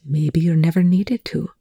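plain reading voice

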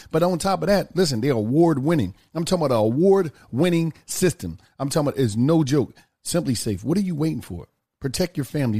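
casual sponsor read, leaning on key phrases. Acted. Host reads a SimpliSafe ad to listeners, pitchman style. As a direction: sell it to listeners.

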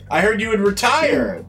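chummy host. Acted. GM voices the serpent host.

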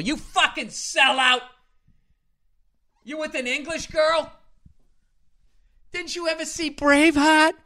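high-pitched mock indignation; outraged Scot. Fellow Scot scolding a man with English girl, high mock outrage. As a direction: squawk it in a high, put-on voice.

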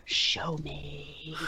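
breathless whispered demand; a hushed echo of a scream; whispered retelling. Retold or imitated. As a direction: whisper it, not the yell it was. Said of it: "screaming at the top of my lungs".

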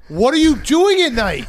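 high, loud disbelieving laugh-question; you can't believe he lives like this; incredulous. Real, not acted. Shocked, laughing at Hank's confession.

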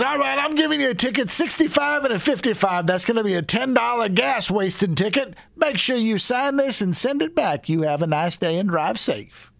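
high, brisk Arizona lawman patter; Arizona cop accent. Retold or imitated. Cop letting driver off lightly, Arizona accent. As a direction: rattle off the ticket as an Arizona cop. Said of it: "this Arizona accent".

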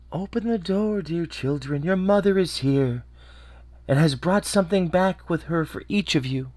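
soft wolf voice. Acted. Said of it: "made his voice soft with it".

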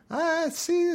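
high, giggly squeak; silly high voice. Retold or imitated. Gaal sees men coming, giggly high squeak. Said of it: "Gaal spake again and said".

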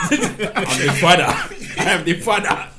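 slow, loud laughing declaration; proud father proclamation. Pretend. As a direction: announce it like a grand proclamation. Mock proud father holding baby.

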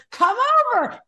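high, stretched call to come over; excited invitation. Retold or imitated. Retelling an invite to the friend, excited invitation.

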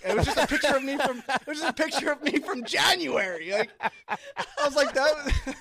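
high, laughing through the story. Real, not acted. Retelling family teasing to friends, laughing high. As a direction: crack up mid-sentence.

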